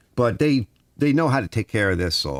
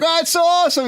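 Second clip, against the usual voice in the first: high, thrilled gush, much higher; excited; 4 dB louder, faster than usual. Retold or imitated. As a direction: gush with wonder at the view. High in the bucket, amazed.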